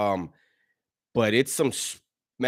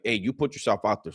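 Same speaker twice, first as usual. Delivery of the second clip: usual speaking voice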